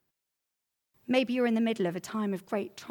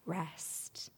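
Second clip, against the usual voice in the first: whispered; 3 semitones lower, 10 dB quieter than usual. Acted. Sermon climax on Jesus' promise.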